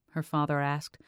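ordinary delivery

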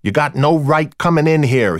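an irate club boss objecting over loud music; angry. A narrator's reading. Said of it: "this was a very angry Gomez".

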